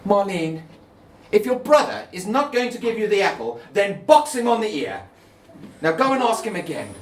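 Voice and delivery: mother sending daughter back upstairs; impatient mother. Acted. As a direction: snap out instructions like a harried mum.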